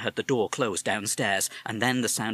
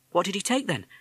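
put-on young boy; 10 semitones higher than usual. Boy asks mother.

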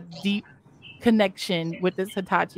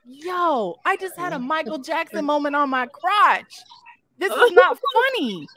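high, put-out protest; mock indignant; 5 semitones higher than usual. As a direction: scold him for laughing, half-joking. Indignant quote to client.

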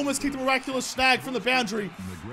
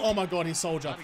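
ordinary talk, nothing marked

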